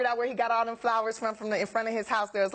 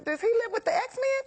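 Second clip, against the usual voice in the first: surprised; 11 semitones higher than usual. Retold or imitated. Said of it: "I was like".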